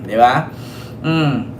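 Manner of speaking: ordinary talk, nothing marked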